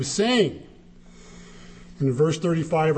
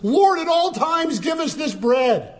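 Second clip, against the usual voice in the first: blurting crowd; 9 semitones higher than usual. Retold or imitated. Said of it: "they had to blurt something out".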